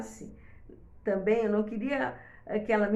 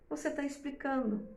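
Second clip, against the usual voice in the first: normal talking voice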